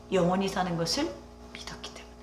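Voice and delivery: whispered, soft conviction. Acted. Pastor confiding to the congregation, in a whisper. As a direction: breathe it out like a secret truth. Quiet climax of the sermon.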